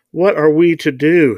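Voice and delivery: high, loud acted yell. A narrator's reading. Mister Thompson to the silent group, yelling. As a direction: yell it as a desperate demand. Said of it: "he yelled".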